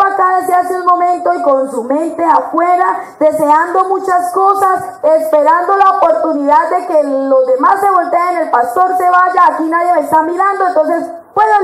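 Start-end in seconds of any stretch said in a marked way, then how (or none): none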